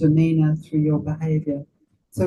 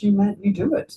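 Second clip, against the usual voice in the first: ordinary talk, nothing marked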